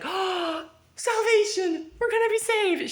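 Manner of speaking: alivio emocionado y agudo; alivio exagerado. Retold or imitated. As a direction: celebra la salvación con entusiasmo fingido. Julie sees emergency exit.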